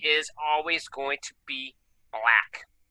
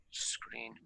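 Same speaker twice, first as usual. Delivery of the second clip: whispering; 3 semitones lower, 20 dB quieter than usual. Real, not acted. Muttering to himself at computer.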